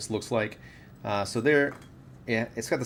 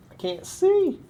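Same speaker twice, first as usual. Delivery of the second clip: squeaky high voice; 5 dB louder, slower than usual. Real, not acted. Squinting for a part, squeaks.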